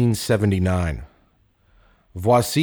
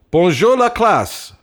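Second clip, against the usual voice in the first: high, loud, slow teacher's greeting; sing-song classroom hello; old French teacher; 11 semitones higher, 7 dB louder than usual. Acted. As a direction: boom it out like a proud old professor.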